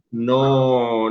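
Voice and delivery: ordinary delivery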